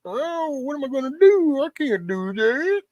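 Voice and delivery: high, slow mock whine; hammed-up helpless moaning, drawn out; mock self-pity. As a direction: whimper it like a fake victim.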